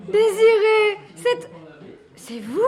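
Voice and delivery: high, loud cry of recognition; light up as you recognise him; joyful surprise. Acted. Cousin recognised after years. (surprise joyeuse, plus aiguë et plus forte)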